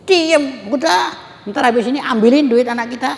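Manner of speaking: high-pitched conspiratorial parent, acted; scheming parent. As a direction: play a parent hushing and plotting. Acts a parent scheming about child's money.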